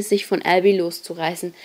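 ordinary delivery, nothing marked

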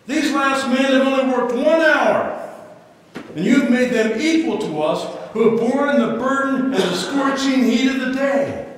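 loud, high, aggrieved protest; complaining workers. Acted. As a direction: shout the grievance like a wronged worker. Preacher acts grumbling workers.

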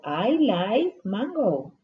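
slow, clear classroom model of I like strawberry; slow model sentence. Shown as a demonstration. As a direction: say it slowly for the kids to copy. (despacio y claro, como modelo)

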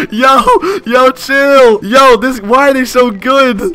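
loud, high, laughing alarm; high-pitched, overwhelmed outburst; panicked disbelief. Real, not acted. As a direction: plead loudly as the attackers close in. Panicking under attack.

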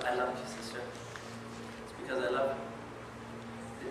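soft, slow, near-breathless loving words; tender. Acted. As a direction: hand her the gift gently. Tender gift to a sister.

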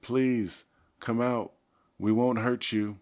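high, loud, pleading call; earnest projected appeal; calling out clearly. A narrator's reading. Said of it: "Tobias tried again".

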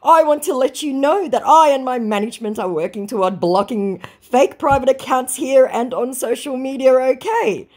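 scammer's pasted notice, read in a mocking high voice. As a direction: ham up the scammer's official warning. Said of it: "he copy-pastes".

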